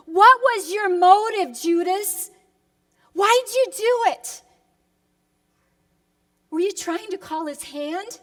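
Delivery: rising, loud pleading questions; pained cry to Judas across the years; impassioned plea to Judas. Acted. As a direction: demand an answer from Judas. Preacher pleads with Judas directly.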